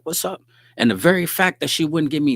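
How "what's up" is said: high, breezy street flirt; smooth pickup greeting. Retold or imitated. Catching Shorty at the mailbox, high flirty greeting. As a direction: toss it up high like a casual pickup line. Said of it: "I'm pulling up on her like".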